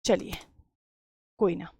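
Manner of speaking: ordinary talk, nothing marked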